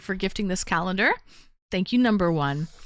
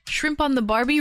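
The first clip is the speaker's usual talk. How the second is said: higher-pitched mock Australian accent; 6 semitones higher than usual. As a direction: put on a playful Australian accent. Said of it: "that's an Australian accent".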